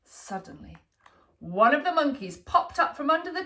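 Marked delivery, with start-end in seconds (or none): none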